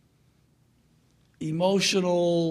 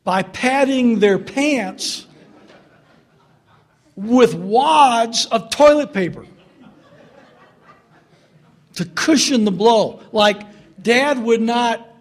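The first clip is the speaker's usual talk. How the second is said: amused build; 4 semitones higher, 9 dB louder, slower than usual. Acted. Amused telling of kids' padding trick.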